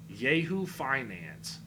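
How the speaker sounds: slow, stretched Yahoo Finance; sneering slow drawl of Yahoo Finance; drawn-out mocking name. Real, not acted. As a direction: drag out Yahoo Finance with scorn.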